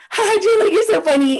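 mock [name]. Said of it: "And then [name] be like".

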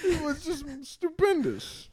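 mock grandiose. Mocking grand words.

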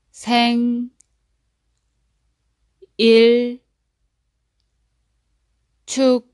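생일 and 축하해요 as separate chunks, long gaps; syllable by syllable. Shown as a demonstration. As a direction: split 생일 축하해요 into syllables for repeating. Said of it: "break it into syllables so that you can repeat after me".

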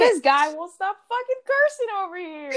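high-pitched hammed-up indignation; mock outrage. Co-host complaining of the other's cursing, mock outraged. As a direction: rant in a put-on, scandalised high voice.

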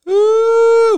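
very high, very loud long "ooh"; imitated noise, full blast. Shown as a demonstration. Storyteller showing the noise once it reached the room, blaring high ooh. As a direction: blast out a piercing sustained hoot. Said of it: "but it was like".

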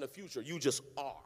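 normal talking voice

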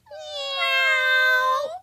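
playful drawn-out cat cry; cat meow. Pretend. Second attempt at cat impression.

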